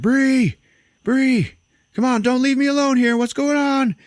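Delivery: high, loud mock yell for someone missing; frantic yelling. Man left alone calls out for Bree, frantic high yelling.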